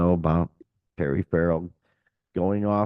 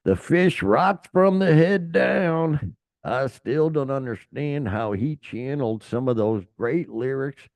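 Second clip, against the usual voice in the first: put-on southern accent; 5 semitones higher than usual. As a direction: read the quote in a light Southern accent, pitched up. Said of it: "I'm gonna use my southern accent".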